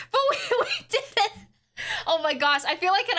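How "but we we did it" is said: high, fast laughing cheer; giddy cheer. Real, not acted. Streamer to chat, giggling high cheer. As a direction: bubble over with glee. Celebrating again.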